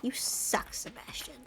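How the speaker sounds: hushed, whispered insult; whispered jab. Real, not acted. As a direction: whisper it under your breath at the character. Mutters insult at game character.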